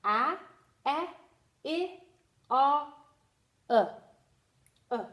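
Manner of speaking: "A, eh, I, uh, uh" as isolated short vowels; teacher modelling short vowel sounds one by one. Shown as a demonstration. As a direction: sound out each short vowel separately, with pauses. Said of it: "are the short sounds".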